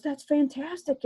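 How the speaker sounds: retold delight, pitched well up; excited high voice. Vicki congratulating her on the new job, thrilled. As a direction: gush with delight for a friend.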